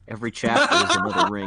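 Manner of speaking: high, fast, laughing through it. Real, not acted. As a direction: giggle out the words. Joking while laughing.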